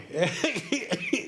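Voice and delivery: real sneeze bursting out; sneezing. Real, not acted. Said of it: "Ooh, bless you".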